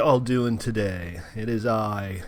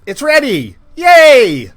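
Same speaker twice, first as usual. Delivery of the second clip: excited cheer; 13 semitones higher, 18 dB louder, much slower than usual. Real, not acted. Announcing server launch to chat.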